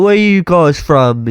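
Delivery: imitating a British stranger, drawn out; slow, high British bloke; nosy British stranger asking; British accent. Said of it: "actual British people".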